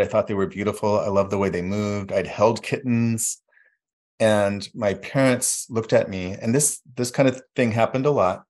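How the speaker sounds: ordinary talk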